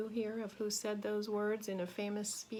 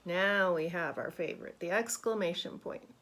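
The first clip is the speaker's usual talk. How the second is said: ordinary delivery, nothing marked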